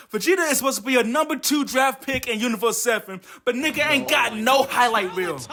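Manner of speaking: usual speaking voice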